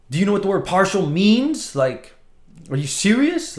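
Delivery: exasperated how-can-you-say-that; incredulous. Retold or imitated. Retelling his reaction to eBay support, high, incredulous.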